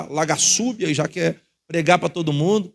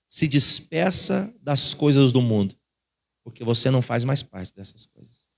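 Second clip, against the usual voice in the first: inner voice; 6 semitones lower than usual. Retold or imitated. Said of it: "ela dizia assim".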